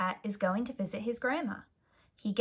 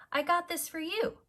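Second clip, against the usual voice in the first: little brother voice; 10 semitones higher than usual. A narrator's reading. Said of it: "says Bob".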